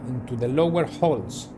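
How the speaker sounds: usual speaking voice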